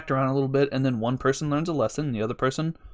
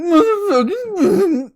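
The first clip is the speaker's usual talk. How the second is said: loud, drawn-out mock tearful scream; imitated angry crying; 8 dB louder, much slower than usual. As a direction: strain it out, angry and sobbing. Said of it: "screaming, ang-, that angry fucking".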